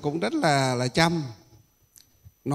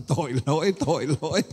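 laughing "tội lỗi"; faster than usual. Real, not acted. Monk laughs, saying "what a sin.

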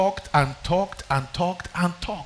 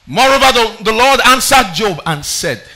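high and loud, slowing on the Lord; loud proclamation; 5 semitones higher, 7 dB louder than usual. Acted. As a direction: announce God's answer with power. Announcing God's answer.

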